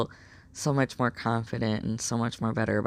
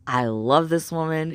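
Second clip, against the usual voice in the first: ordinary delivery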